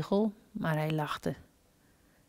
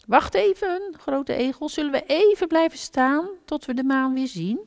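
put-on high, childlike little hedgehog voice; a small hedgehog calling out, pitched way up; 9 semitones higher, 5 dB louder than usual. A narrator's reading. Little hedgehog asks big hedgehog.

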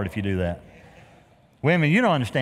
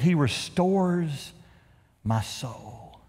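very slow, fading to a hush on my soul; soft, drawn-out psalm line; reverent recital; 3 dB quieter, much slower than usual. Acted. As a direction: linger on each word like a prayer.

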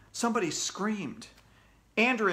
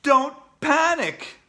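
slow, loud, raised command; shouted warning; 11 semitones higher, 9 dB louder, much slower than usual. A narrator's reading. AJ to viewers during the invasion, shouting. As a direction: shout it with big drawn-out words. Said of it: "I shouted".

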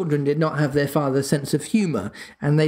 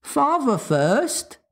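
put-on high, slow child's voice; drawn-out, wary small-boy insistence; suspicious little boy; 12 semitones higher, much slower than usual. A narrator's reading. Small son distrusting his father, suspicious little boy. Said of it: "who was of a suspicious nature".

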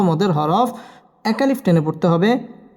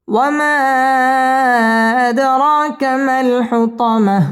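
slow, sung tajweed chant; Quran recitation; 5 semitones higher, much slower than usual. Shown as a demonstration. Teacher reciting the surah for students to follow, chanted. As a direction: let each line ring out melodically.

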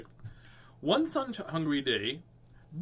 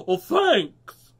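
high, loud dolphin reply; excited thanks; 8 semitones higher, 12 dB louder than usual. Acted. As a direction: call back thanks mid-leap. Excited dolphin replies in story.

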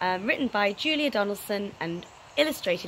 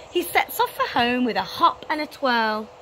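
ordinary delivery

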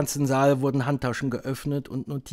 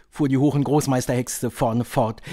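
usual speaking voice

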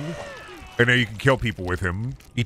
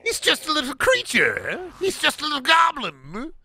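very high, innocent villain voice; Joker voice; 13 semitones higher than usual. Acted. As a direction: play it sweet and innocent, very high. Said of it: "What if the Joker was taking care".

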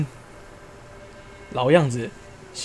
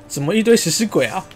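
startled outcry; 3 semitones higher than usual. Real, not acted. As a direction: blurt it out in real alarm. Ghouls swarm the village.

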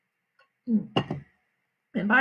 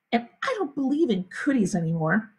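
usual speaking voice

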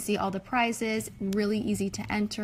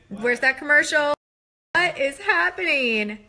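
shocked; 5 semitones higher, 5 dB louder than usual. Real, not acted. Shocked by the commercial.

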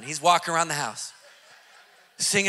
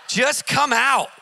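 slow, louder, higher order; firm command; 5 semitones higher, 6 dB louder, slower than usual. Retold or imitated. Pastor ordering the demon out, slow and loud. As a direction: hammer the demand home slowly.

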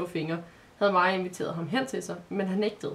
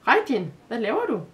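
louder question with big swooping pitch; astonished; 4 semitones higher, 6 dB louder than usual. A narrator's reading. Girl asks what the boy is doing, astonished. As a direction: ask it in open bafflement. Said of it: "spurgte hun forbløffet".

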